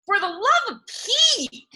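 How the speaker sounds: high exasperated outburst; exasperated cry. Acted. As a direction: cry out in dismay. Dragon's man cries in battle.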